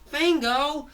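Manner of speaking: high, loud cartoon yell; protesting shout. Fangy objects as Fango grabs his friend, protesting shout. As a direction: yell the name, rising and held.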